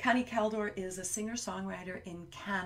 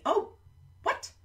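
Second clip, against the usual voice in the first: surprised; 11 semitones higher, 3 dB louder than usual. Acted. Surprised by child's idea.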